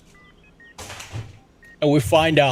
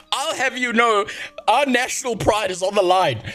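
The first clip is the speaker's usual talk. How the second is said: higher, slowed pompous official voice; mocking the minister; 7 semitones higher than usual. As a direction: lampoon a proud politician. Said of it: "He's like".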